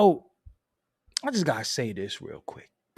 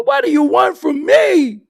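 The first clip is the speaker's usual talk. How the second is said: much higher, louder hammed-up yell; mock shout; 14 semitones higher, 12 dB louder than usual. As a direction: blow up at the listener in a put-on voice. Mocking pushy rapper.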